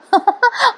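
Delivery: quick high amused laugh; short laugh. Real, not acted. Vlogger laughing at the mother's rant, short real laugh.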